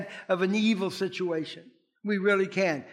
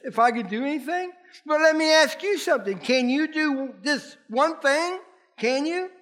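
high, rising rhetorical questions; pointed challenge; 7 semitones higher than usual. Acted. Jesus challenges the father to believe, high pointed questions. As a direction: turn the question back on the listener.

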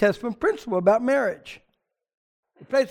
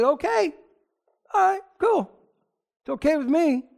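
much higher, breezy okay; easygoing Abraham; 8 semitones higher than usual. Acted. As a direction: toss off the consent lightly. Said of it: "He said".

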